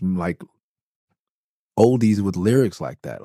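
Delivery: ordinary talk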